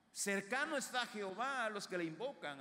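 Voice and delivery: high, ringing scripture proclamation; fervent preaching. Real, not acted. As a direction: proclaim the verse to the whole congregation. Proclaims a psalm verse.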